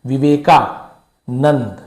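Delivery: Vivekananda very slowly, split before नंद; drawn-out, broken-up Vivekananda; sounded out. Shown as a demonstration. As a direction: say Viveka, pause, then नंद. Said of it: "Vivekananda बोल के देखिए".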